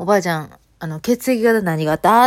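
loud, very slow, spelled-out question. Retold or imitated. Asking deaf granny loudly.

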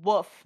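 WOOF said with the L of wolf dropped; wolf without L. Retold or imitated. Reporting an acquaintance's pronunciation, WOOF with no L. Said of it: "they also say".

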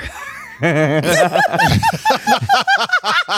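high shrieking laugh; loud burst of laughter. Real, not acted. Reveals reunion is at bar.

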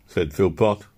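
ordinary delivery, nothing marked

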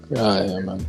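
normal talking voice

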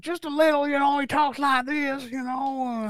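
high Southern twang, lightly imitated; little Southern twang. Shown as a demonstration. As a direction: talk like this, with a small Southern twang. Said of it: "you got a little twang".

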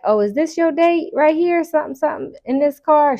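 high, playful teasing; lightly voiced joking stranger; teasing girl voice. Girl teasing about the car.

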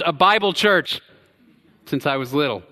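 ordinary talk

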